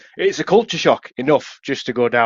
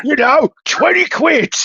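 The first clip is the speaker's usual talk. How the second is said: high, slow mimic of the lads asking twenty quid; drawn-out high imitation of a local; mock high local lad; 4 dB louder, slower than usual. Imitating local lads quoting a price, mock high voice. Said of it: "They're like".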